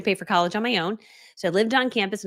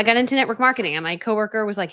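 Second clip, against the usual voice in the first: normal talking voice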